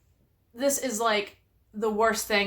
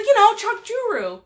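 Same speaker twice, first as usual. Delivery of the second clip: high, louder mock insistence; imitating husband insisting; 11 semitones higher, 5 dB louder than usual. Wife mimics husband insisting on a wrong name, high and louder. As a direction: lightly play him as if everyone knows.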